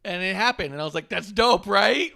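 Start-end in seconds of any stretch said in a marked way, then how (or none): 1.1-2.1 loud laughing shout; a real laughing shout of glee; excited. Real, not acted. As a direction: yell it out laughing. Excited reaction retold.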